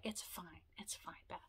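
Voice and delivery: whispered, soft and gentle comfort; reassuring whisper. Retold or imitated. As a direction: hush the words as if to calm her.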